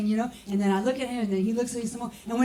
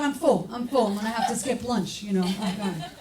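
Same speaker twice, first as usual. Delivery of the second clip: ordinary talk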